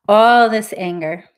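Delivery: loud burst of venting; voiced anger. Shown as a demonstration. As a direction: throw it out with force on ALL. Modelling venting while tapping.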